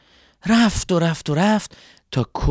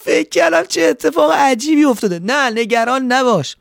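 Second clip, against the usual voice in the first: higher, louder voice still laughing; amused and relieved; 9 semitones higher, 4 dB louder than usual. Acted. Scarecrow reassuring the swallow, still laughing. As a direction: chuckle through the words.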